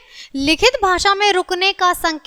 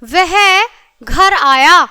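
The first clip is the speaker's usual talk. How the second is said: "वह", a break, then louder "घर आया"; comma pause; 5 dB louder, much slower than usual. Shown as a demonstration. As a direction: hold off after वह before घर आया. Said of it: "तो हमने क्या लगाया? अल्प विराम".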